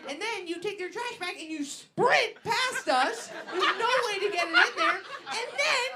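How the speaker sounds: ordinary talk, nothing marked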